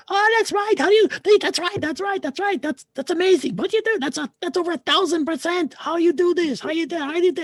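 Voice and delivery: very high, squealing disbelief from a grown man; imitating a squeal. Doctor squeals in amazement at the eye chart result, very high squealing. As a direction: squeak it out like a stunned man who can't find words. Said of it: "a full-grown man squeal in a weird way".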